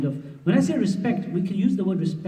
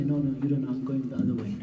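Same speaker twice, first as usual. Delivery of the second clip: stubborn husband; 4 semitones lower, 8 dB quieter than usual. Retold or imitated. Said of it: "but he will still say".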